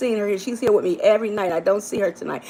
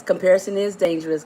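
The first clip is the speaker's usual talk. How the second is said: ordinary talk, nothing marked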